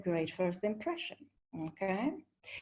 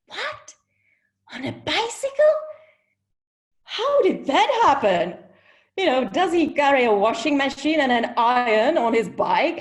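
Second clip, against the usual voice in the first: much higher, louder, astonished squeal; incredulous disbelief; 4 semitones higher, 7 dB louder than usual. Retold or imitated. Friends at dinner hear he came by bike, astonished. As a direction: shriek it in mock disbelief at the table.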